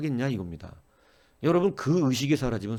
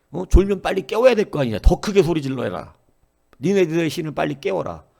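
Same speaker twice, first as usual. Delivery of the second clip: a lazy jeer that swells into a loud, higher taunt; mocking taunt; 3 semitones higher, 6 dB louder than usual. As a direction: goad the prophets to shout louder for their god. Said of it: "하품하면서 조롱합니다". (점점 커지는 조롱조 야유)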